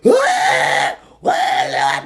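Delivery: drawn-out wailing cry; wailing shout. Real, not acted. Ranting vlogger wails in anguish. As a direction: wail it out in despair.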